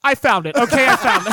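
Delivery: loud, high, triumphant cry of discovery; excited shout. Real, not acted. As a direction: yell it out like you just found treasure. Finds the lost binder, gloating.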